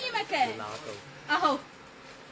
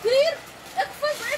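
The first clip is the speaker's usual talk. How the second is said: excited shout; 8 semitones higher than usual. Real, not acted. Excited seller urging buyers on.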